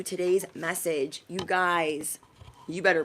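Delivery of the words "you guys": ordinary talk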